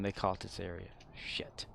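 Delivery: hushed whisper, barely voiced. Real, not acted. Player sneaking past enemies, whispering. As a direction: breathe it so nobody hears.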